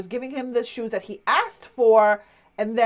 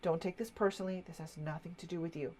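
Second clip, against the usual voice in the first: much softer, fast, low reassurance; calm aside; 5 semitones lower, 14 dB quieter, faster than usual. Retold or imitated. Elderly customer quietly reassuring the young clerk, soft and fast. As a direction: reassure her quietly, it's not about you. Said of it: "he goes".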